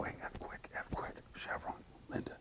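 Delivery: hushed play call under the breath; soft whispered signal to the receiver. Retold or imitated. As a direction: say it low so only your man hears. Quiet play call after yell.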